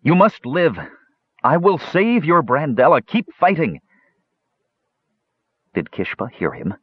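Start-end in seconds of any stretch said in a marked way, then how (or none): 0.0-3.8 urgent high-pitched plea; crying out. Acted. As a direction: call out to a dying man, pleading. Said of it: "Tanis cried out".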